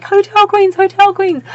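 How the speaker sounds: high, loud chanting squeal; high-pitched excited chant; fangirl cheer. Acted. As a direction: cheer the title like a superfan. Chanting favourite book title like fan.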